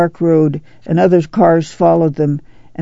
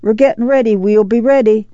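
calling out; 5 semitones higher than usual. A narrator's reading. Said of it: "came the call".

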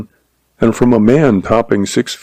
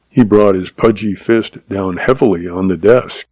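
plain reading voice

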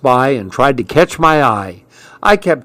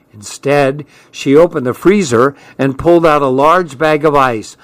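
usual speaking voice

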